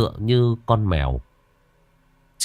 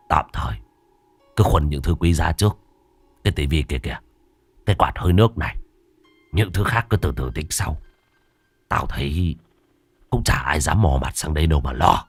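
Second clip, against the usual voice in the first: lower, unhurried boss voice; gang boss; 5 semitones lower than usual. A narrator's reading. Boss tells his henchmen what to carry first, low and assured. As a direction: give orders low and assured.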